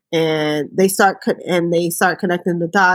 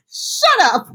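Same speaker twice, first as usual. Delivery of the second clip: mock snapping mother; 11 semitones higher than usual. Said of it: "She listens to her and says".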